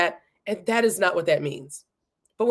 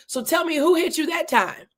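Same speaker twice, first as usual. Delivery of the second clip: higher, sing-song mocking jeer; mocking taunt; 7 semitones higher than usual. Guards mocking beaten Jesus, jeering taunt. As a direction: taunt him like a schoolyard bully. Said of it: "they're just straight up mocking him".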